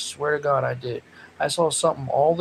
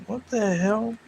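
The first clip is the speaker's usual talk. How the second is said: slow, higher exasperated question; 7 semitones higher, slower than usual. Real, not acted. Host puzzled that the door was left unlocked, exasperated. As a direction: drag it out in disbelief.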